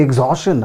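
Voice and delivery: एग्जॉशन as eg-ZAW-shun; plain SH in एग्जॉशन; common mispronunciation. Shown as a demonstration. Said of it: "That is also wrong". (एग्जॉशन का गलत उच्चारण)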